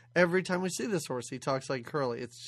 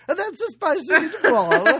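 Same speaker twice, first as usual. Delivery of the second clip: loud, high, laughing mock Italian; a loud, hammed-up Italian accent; terrible Italian accent; 10 semitones higher, 10 dB louder than usual. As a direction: ham up a cheesy Italian-American line, laughing. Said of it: "in a terrible Italian accent's like".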